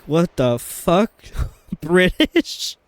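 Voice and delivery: slow, drawn-out incredulous laugh; amused, stretched-out disbelief; baffled disbelief. Retold or imitated. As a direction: retell it stunned and laughing. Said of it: "And we were like".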